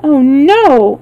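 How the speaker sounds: a touch of sympathetic alarm; exclaiming. A narrator's reading. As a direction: exclaim it in gentle protest. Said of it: "exclaimed Dorothy".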